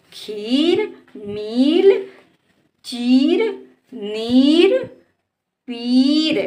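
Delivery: खीर, मील, चीर, नीर, पीर each with rising long ee; word recital. Shown as a demonstration. Teacher reads the list back for pupils, vowels lengthened. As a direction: read the list as separate drawn words. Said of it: "इस तरह से शब्दों को हम पढ़ते हैं".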